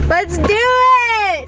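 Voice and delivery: high, slow drawn-out cheer; rally everyone to go; excited cheer. Real, not acted. Cheering departure.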